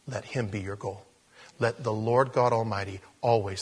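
ordinary talk, nothing marked

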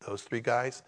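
usual speaking voice

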